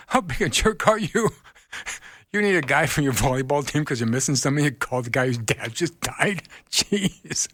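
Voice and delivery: high, laughing mock outrage; half-laughing, pretend to scold the friend; playful disbelief at a clueless friend; incredulous retort. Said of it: "I'm like".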